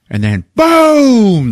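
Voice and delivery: long, loud, high drawn-out boom; big boom. Retold or imitated. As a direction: land the final shot hard. Final shot of scene.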